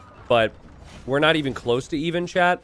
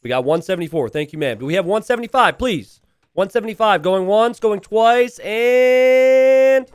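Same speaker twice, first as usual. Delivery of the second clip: building auction call, louder to the end; going once, going twice, rising hard; auctioneer; 6 semitones higher, 8 dB louder than usual. Pretend. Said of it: "casting this like an auctioneer".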